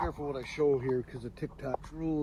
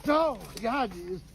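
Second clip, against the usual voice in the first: being chased; 3 semitones higher, 9 dB quieter than usual. Real, not acted. Hit by something while running.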